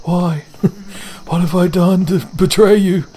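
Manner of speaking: high, anguished lament; hammed-up old monk; despairing monk. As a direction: plead to heaven in mock despair. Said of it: "I was like".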